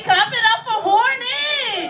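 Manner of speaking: high, slow crowd-rousing shout; hype shout. Real, not acted. Comic rallying the audience to clap, loud. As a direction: whip the crowd into cheering.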